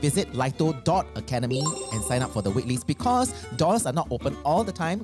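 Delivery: ordinary talk, nothing marked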